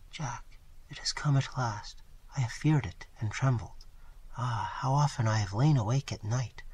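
soft, strained, near whisper. Acted. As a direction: confess it barely above a whisper. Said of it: "in a voice changed and strained".

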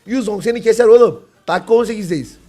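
normal talking voice

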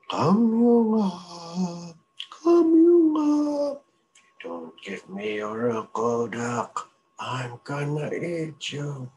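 very high, loud, slow menacing call; monster voice. Acted. Creature outside the door threatens the mink again, menacing call. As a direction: stretch it out like a hungry creature.

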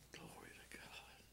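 breathy hushed praise; a sudden whispered glory to God. Real, not acted. Whispered praise mid-sermon.

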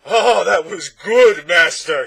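huge high-pitched slow bellow; bellowing monster. A narrator's reading. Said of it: "he bellowed".

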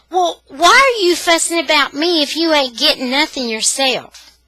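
high, loud indignant challenge; indignant child. A narrator's reading. Tanya spinning around to challenge the one rushing her, indignant. Tanya spins round and argues back.

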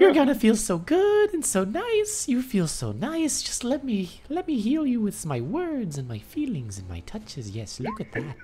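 high, soothing fairy voice with laughter; pixie. Acted. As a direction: coo like a flirty fairy. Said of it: "what is it saying?".

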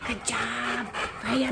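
soft praise. Real, not acted.

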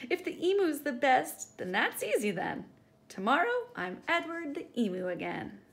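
laughing Edward. Acted. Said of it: "he laughed as he said".

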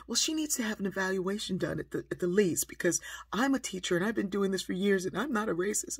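agitated self-justification building in volume; frazzled teacher. Retold or imitated. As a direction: imitate a flustered teacher insisting on credentials. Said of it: "she's all frazzled".

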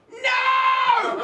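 long, high, loud scream; imitated scream. Kid screaming into the speaker stack, loud high scream. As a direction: scream it out at full volume. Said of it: "just screams into the speaker".

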